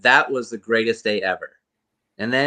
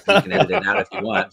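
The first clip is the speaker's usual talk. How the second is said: cheeky aside; 10 semitones higher, faster than usual. Retold or imitated. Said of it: "And I go".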